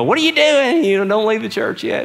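high, pleading parent voice; a worried parent calling him back. Retold or imitated. Parents holding him back in church.

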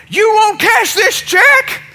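high, loud, drawn-out mock yell; crazed anger. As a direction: imitate an outraged customer, slow and shrieking high. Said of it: "he's getting a little crazy at this point".

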